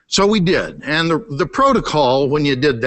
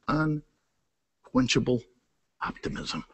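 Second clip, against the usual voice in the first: hushed emphasis; 5 semitones lower, 11 dB quieter, slower than usual. Acted. Preacher's quiet emphatic punchline.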